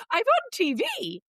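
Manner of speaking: high, slow, incredulous; mock exasperation. Aunt tells her nephew, half-laughing, in disbelief. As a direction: spell it out in disbelief.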